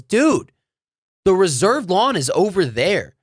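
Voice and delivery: high, emphatic "dude", then pointed explanation; exasperated correction. Retold or imitated. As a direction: imitate a dad setting someone straight. Dad sets the angry guy straight.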